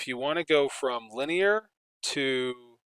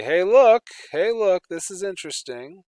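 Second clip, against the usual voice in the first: excited; 7 semitones higher, 11 dB louder than usual. Pretend. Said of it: "and then we can say".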